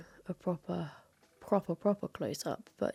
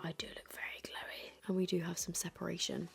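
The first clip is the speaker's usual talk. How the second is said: whispering; 9 dB quieter than usual. Real, not acted. Whispered close-up inspection.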